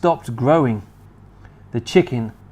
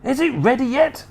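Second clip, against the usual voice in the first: high, excited and impatient; eager Mister Cranky; 10 semitones higher than usual. Acted. Mister Cranky urging George over the boiling pot, impatient.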